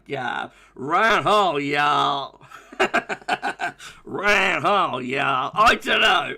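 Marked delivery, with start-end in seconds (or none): 0.7-2.4 badly faked American Southern drawl; American accent. Shown as a demonstration. Streamer retrying after needing more work, badly faked American accent. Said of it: "I'm not very good with accents". 4.1-5.5 badly faked, hammed-up American drawl; American accent. Shown as a demonstration. Streamer admitting he's not good with accents, mock American accent. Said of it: "I'm not very good with accents".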